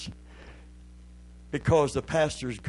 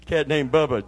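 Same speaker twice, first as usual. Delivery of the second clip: usual speaking voice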